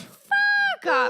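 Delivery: very high, squeaky mock "fuck off"; joking high "fuck off". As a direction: squeal it like a joke. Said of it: "We say it kind of as a joke".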